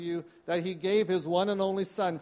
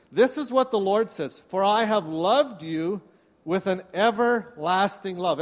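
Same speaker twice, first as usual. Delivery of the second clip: scripture reading; 2 semitones higher, 4 dB louder than usual. Acted. Pastor reading scripture to his church, proclaiming. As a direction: proclaim the verse to the congregation. Pastor reads Jeremiah aloud.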